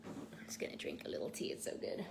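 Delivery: breathy whispered aside over her tea. Real, not acted. Vlogger sipping tea, whispering to herself. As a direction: drop to a quick private whisper.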